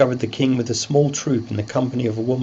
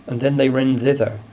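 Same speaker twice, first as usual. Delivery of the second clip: ordinary talk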